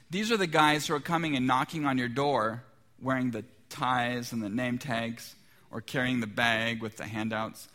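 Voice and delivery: ordinary delivery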